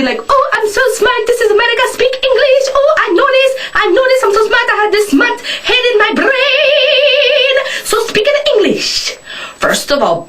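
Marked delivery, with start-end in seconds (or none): 0.3-9.2 lampoon the smug know-it-all in a high, taunting voice; mocking smart-aleck. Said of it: "act all smart and high and mighty".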